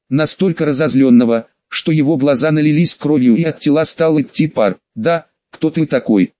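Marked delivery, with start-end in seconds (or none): none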